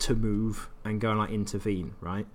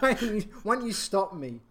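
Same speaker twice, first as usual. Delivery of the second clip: mock dad taunt; 11 semitones higher than usual.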